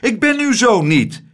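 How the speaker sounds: a high, loud protest; shouting. Acted. Abeltje shouts at the lady that he is not her son. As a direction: yell it to get free. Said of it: "schreeuwde hij".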